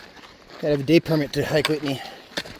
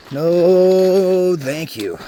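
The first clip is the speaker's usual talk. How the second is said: loud, high, long-drawn-out refusal; emphatic refusal; 4 semitones higher, 10 dB louder, much slower than usual. Real, not acted. Emphatic refusal of the uphill climb.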